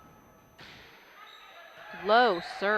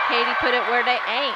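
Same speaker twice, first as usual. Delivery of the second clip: excited call; 4 semitones higher, 8 dB louder than usual. Real, not acted.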